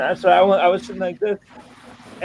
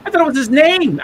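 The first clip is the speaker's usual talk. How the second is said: high, louder shocked protest; dismayed protest; 11 semitones higher, 5 dB louder than usual. Retold or imitated. Speaker learning the nickname was an insult, high dismayed protest. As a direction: retell it as a horrified self-defence.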